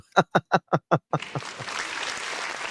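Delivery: high, wheezy fit of laughter; long helpless laugh. Real, not acted. Host cracking up over buzzing Sherry, helpless laughter. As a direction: crack up and let it run out breathlessly.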